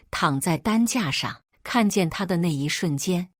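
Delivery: ordinary delivery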